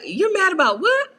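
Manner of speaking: high, incredulous quoted retort; mock incredulous. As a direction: ask it high and baffled, like a daughter to her mom. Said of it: "I'll be like".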